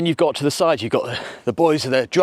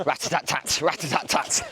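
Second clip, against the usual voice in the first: imitated gunfire; 5 dB quieter than usual. Imitating musket fire with a laugh.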